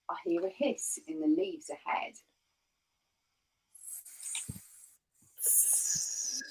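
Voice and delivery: hushed spooky whisper; secretive storybook whisper. Acted. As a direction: whisper it as if the snake might hear. Gruffalo hears hiss, spooky.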